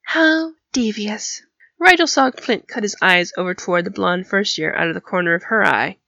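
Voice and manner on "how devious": high, drawn-out admiration; slow, arch and high-pitched; appreciative Pansy. A narrator's reading. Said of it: "Pansy chuckled appreciatively".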